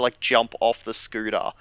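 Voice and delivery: ordinary talk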